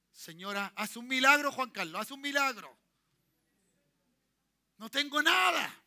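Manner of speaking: loud, begging cry for a miracle; desperate plea. Retold or imitated. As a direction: shout out a pleading prayer.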